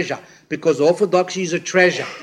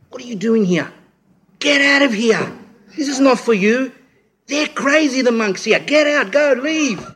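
higher, pushed voice like a nervous policeman warning him off; urgent warning; 7 semitones higher than usual. Retold or imitated. Policeman urging a young pilgrim to leave, high and urgent. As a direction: hurry him away, urgently. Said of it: "He goes".

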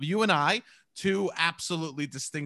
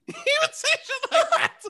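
high, loud, words breaking into laughter; laughing while talking; 5 dB louder than usual. Real, not acted. Recalling dad's blunt saying about mother, laughing while talking. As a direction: crack up through the whole line. Cracks up after quoting dad.

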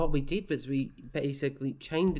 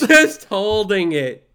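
slow, loud laughing confirmation; loud laughing echo; 5 semitones higher, 15 dB louder, slower than usual. Real, not acted. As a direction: bellow it back with a laugh.

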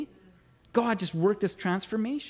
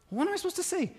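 incredulous; 10 semitones higher, 6 dB quieter, faster than usual. Retold or imitated. Ray protesting to God.

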